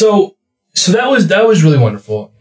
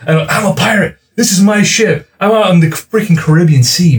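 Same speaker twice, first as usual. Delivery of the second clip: excited; 7 semitones higher than usual. Real, not acted. Reliving pirate game thrill.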